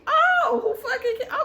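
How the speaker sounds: very high, loud indignant squeal; mock outrage. Woman reacting to Mia's insult, mock outraged. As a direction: shoot way up high in disbelief.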